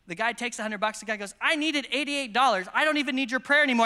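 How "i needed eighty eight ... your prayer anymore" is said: dismissive quote. Retold or imitated. Ungrateful man quoted after taking money.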